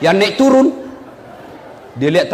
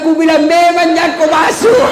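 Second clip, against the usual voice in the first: high, loud told-you-so shout; mock shout; 8 semitones higher, 4 dB louder than usual. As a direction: shout it out, half laughing. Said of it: "Dia bilang". (teriakan keras sambil tertawa)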